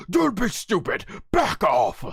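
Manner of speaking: irritated warrior waving off help; put-on voice, starting high; mock-angry rebuff; Vegeta. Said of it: "And Vegeta's like".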